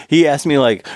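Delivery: ordinary talk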